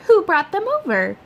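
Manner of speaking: light mother voice; mother's voice. A narrator's reading. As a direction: ask it up high like mom. Mother asks.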